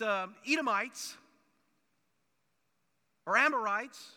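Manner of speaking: loud, rising preacher's build, hammering each point; preaching at full force. Acted. Preacher rousing the congregation about Israel, loud full-force preaching.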